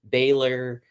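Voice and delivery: normal talking voice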